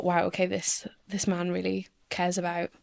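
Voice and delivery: ordinary talk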